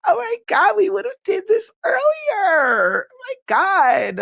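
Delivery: overjoyed squeal. Real, not acted. Streamer squealing to her chat over a new nickname, near-crying high squeal. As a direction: squeal it out like you might cry from happiness.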